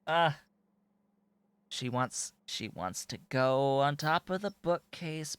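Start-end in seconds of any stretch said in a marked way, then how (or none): 0.1-0.4 coaxing pet talk. Real, not acted. Pleading with climbing kitten.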